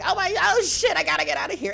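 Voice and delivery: high squeaky mock cat panic; the scared cat Jinx panicking at the thunder, high mock voice; panicked cat voice. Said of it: "she'd be like".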